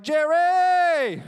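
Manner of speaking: high, very loud, long-held name; hollered greeting. Retold or imitated. Student spotting security guard outside office, hollering his name. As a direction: holler the name, stretching the last syllable.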